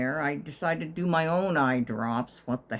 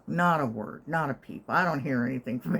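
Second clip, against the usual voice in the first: normal talking voice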